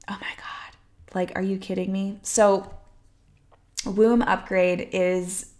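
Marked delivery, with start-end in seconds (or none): 0.1-1.1 a breathless, hushed oh my God; awed whisper. Real, not acted. Coach to her followers, stunned into a soft whisper. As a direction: whisper it as if she can't believe it. Overwhelmed by her own offer name.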